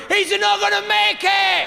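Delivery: high, loud, slow cry; panicked shout. Retold or imitated. Family on the dock as father jumps in water, shouting. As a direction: yell in alarm as he jumps. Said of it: "The last thing you heard".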